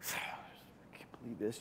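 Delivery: slow, breathy whispered disbelief; exasperated whisper. Retold or imitated. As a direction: sigh it out under your breath, fed up. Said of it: "rolling my eyes".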